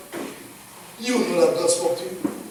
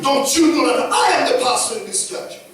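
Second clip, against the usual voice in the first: loud, raised mock outburst; indignant leader; 5 semitones higher, 5 dB louder than usual. Pastor acting out a leader demanding recognition, indignant shout. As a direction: pull rank on the congregation, shouting. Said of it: "a leader doesn't talk like that".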